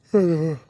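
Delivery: long loud yawn; big yawn. Real, not acted. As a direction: let out a sleepy yawn. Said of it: "That last yawn".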